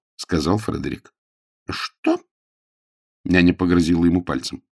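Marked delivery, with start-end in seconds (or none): 1.7-2.2 very high-pitched disbelieving question; outraged nanny. Acted. Nanny hears the boy refuse, outraged. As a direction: squeak it in indignation.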